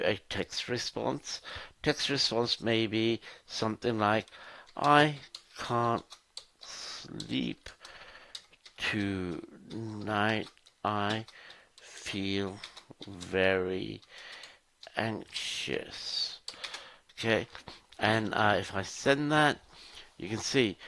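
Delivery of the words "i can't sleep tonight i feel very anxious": word by word with long pauses; slow, halting read-out, trailing softer as if typing it; dictating while typing. Shown as a demonstration. Speaks words while typing them in.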